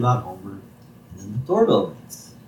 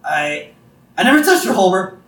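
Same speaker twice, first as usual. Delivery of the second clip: much higher, louder, panicky Moe; panicked Moe; 7 semitones higher, 4 dB louder than usual. Retold or imitated. Moe caught by Homer, panicked and loud. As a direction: blurt out a guilty denial in a high voice. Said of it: "He's like".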